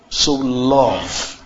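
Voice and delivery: ordinary talk, nothing marked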